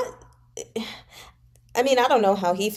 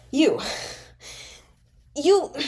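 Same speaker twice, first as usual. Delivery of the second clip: a mild rising sputter, higher each time; 6 semitones higher than usual. A narrator's reading. Pan Lin sputters at Xue Xiuyi, mildly enraged. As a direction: choke on the words in rage. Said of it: "he sputtered".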